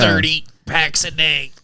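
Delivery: slow imitated smoker's rasp; raspy smoker. Quoting the old commercial lady to friends, slow and raspy. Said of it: "the old commercial of the lady".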